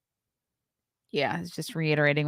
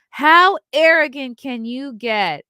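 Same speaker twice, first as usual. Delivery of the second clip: exasperated outrage; 8 semitones higher, 9 dB louder, slower than usual. Real, not acted. Outraged at the quoted remark.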